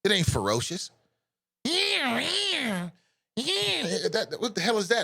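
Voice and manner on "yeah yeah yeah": high mewling mock roar; cat-like tiger cry. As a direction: yowl like a kitten failing to be ferocious. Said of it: "That's like a cat".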